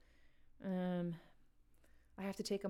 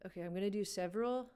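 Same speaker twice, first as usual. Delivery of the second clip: ordinary talk, nothing marked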